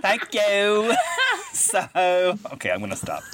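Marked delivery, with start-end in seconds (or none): 0.0-1.0 hammed-up British accent, rising and laughing; mock British accent. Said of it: "really amazing British accent". 1.0-1.5 short high burst of laughter. Real, not acted. Laughs at own accent.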